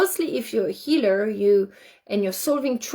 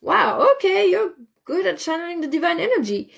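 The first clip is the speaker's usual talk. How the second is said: amazed delight; 8 semitones higher than usual. Retold or imitated. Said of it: "I'm like".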